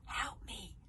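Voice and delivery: hushed plea for help; scared hushed plea; urgent whisper. Acted. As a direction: whisper it so no one wakes. Trapped boy pleading in hiding.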